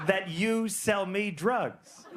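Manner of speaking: high, halting, incredulous question; mock bewilderment, slowly spelled out; baffled questioning. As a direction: ask in broken phrases, pausing in disbelief. Baffled question to dealer, acted out.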